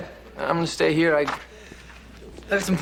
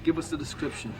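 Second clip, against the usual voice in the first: quiet demand; 8 dB quieter than usual. Acted. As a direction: demand it softly from the informant. Interrogator's first, low demand.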